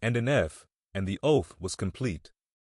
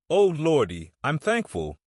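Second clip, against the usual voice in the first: higher and louder burst of relief on Oh, lordy; a scared boy suddenly thankful; relieved exclamation; 6 semitones higher, 4 dB louder than usual. A narrator's reading. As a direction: Tom blurting his relief to Huck. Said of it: "whispered Tom".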